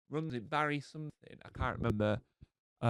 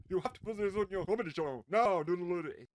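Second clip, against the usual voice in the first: higher, fast mock nagging; mock objection; 5 semitones higher, 4 dB louder than usual. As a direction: put on a high, hurried busybody objection. Mocking an imagined objection.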